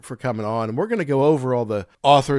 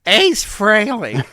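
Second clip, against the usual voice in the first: emphatic repeat; 9 semitones higher, 5 dB louder, much slower than usual. Real, not acted. Repeats name with exaggerated emphasis.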